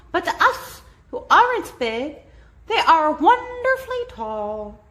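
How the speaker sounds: a speck-sized mayor full of thanks; squeaky little mayor voice; tiny grateful mayor. A narrator's reading. Mayor voice held.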